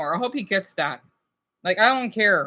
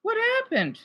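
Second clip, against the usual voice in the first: much higher, stunned disbelief; shocked; 13 semitones higher than usual. Real, not acted. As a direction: cry out in disbelief. Shocked repeat.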